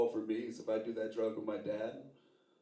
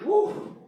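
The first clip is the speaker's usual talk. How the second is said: high woo; 8 dB louder than usual. Acted. Sweating exclamation.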